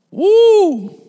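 a sudden loud high woo; loud high whoop. Real, not acted. Preacher whooping before the point.

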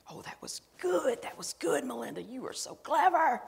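high, half-whispered mock praise to herself; gleeful self-congratulation. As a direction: squeal breathily at your own cleverness. Acting out praising herself alone.

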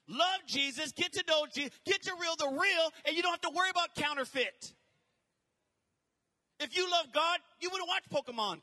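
rising, loud sermon build; building from firm to shouting; fiery preaching. Acted. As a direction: preach it to the crowd with fire. Preaching at force.

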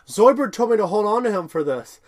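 chirpy Amy. Retold or imitated. Amy telling the guys about the bands, chirpy and loud. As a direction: proudly show off the rubber bands. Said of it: "Amy's like".

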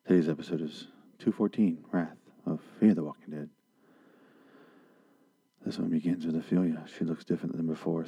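low, soft radio host voice; a lightly done calm public radio host; NPR voice. Pretend. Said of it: "I'm gonna talk like I'm on N P R".

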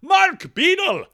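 high, loud, drawn-out name call; hammed-up sign-off. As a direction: announce the name big, stretched and high. Hammed-up sign-off of listener's email.

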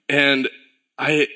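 usual speaking voice

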